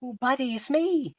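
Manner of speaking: put-on high, excited teen cabin boy; eager cracking teen voice. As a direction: gush like an overeager kid. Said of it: "and he says".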